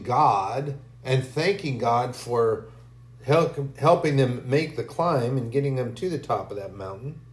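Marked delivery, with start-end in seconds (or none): none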